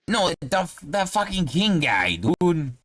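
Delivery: high-pitched exasperated Bostonian; Boston halfling. Acted. Halfling corrects the traveller about the hat, high Boston accent. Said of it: "Yeah, he's from Boston".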